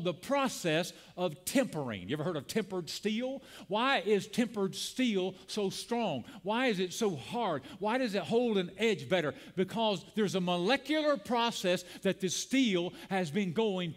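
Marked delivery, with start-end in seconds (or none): none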